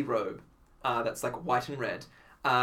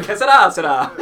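laughing; 12 semitones higher, 12 dB louder than usual. Retold or imitated. Clown delivers pun.

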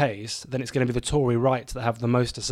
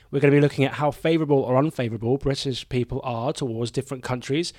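ordinary delivery

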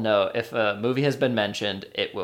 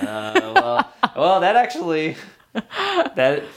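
high, loud burst of laughter; laughing; 9 semitones higher, 7 dB louder, slower than usual. Real, not acted. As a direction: laugh through the words. Host laughing at answer.

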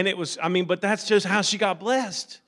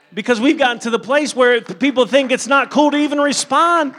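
raised pulpit voice swelling louder and higher; building preaching; 6 semitones higher, 9 dB louder than usual. Real, not acted. Preacher urging people to respond when God moves, increasingly forceful.

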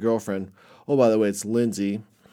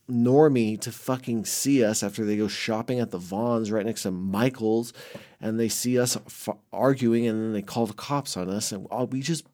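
ordinary talk

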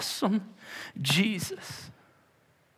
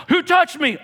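high, loud shouted question; yelling; 12 semitones higher, 6 dB louder than usual. Acted. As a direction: shout it as a demand. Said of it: "And Jesus yells".

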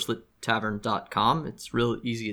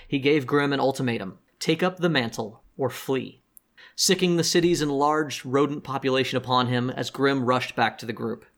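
story narration; 3 semitones higher than usual. Acted. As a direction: read it like a show recap. Recap narration.

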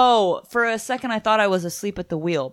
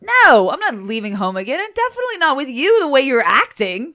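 indignant shouting; 8 semitones higher, 6 dB louder than usual. Acted. Voices Dawn refusing.

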